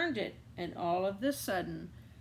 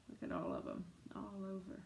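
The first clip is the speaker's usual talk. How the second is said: soft whisper pointing at the picture; whispered aside; 3 semitones lower, 11 dB quieter, faster than usual. Real, not acted. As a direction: breathe it like a secret. Quiet aside to listeners.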